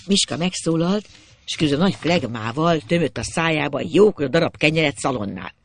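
plain reading voice

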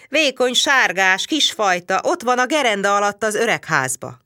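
a suspicious chieftain calling to his wife; gruff barbarian chief. A narrator's reading. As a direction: barbarian chief bellowing for the letter. Reads Csát's angry speech.

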